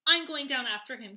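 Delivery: loud determined cry; cried out. A narrator's reading. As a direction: call it out bravely. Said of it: "cried James".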